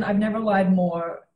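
ordinary delivery

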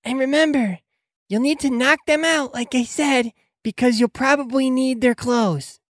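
high-pitched character voice; Jennifer. Acted. Helper reminding the crew of the plan, high character voice. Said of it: "You also remember Jennifer saying".

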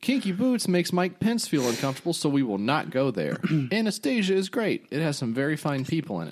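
high, blustery cartoon politician; cartoon Trump voice. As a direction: puff it up like a bragging cartoon president. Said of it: "this is in the voice of a cartoon Trump".

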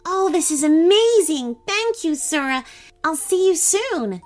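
high, delighted thanks; smiling call. A narrator's reading. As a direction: call out happily with an audible smile. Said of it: "Christine called out with a smile".

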